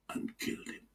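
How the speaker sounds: a grim whispered finish; softly whispered doom; whispered ending. Acted. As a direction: drop it to a whisper. Grim punchline of the fable.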